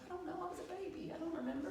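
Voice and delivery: put-on high, soft childlike protest; small helpless voice. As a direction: answer helplessly, high and quiet. Tearful reply to healer, retold.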